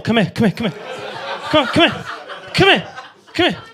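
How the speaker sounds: coaxing call. Acted. Coaxing someone to come over.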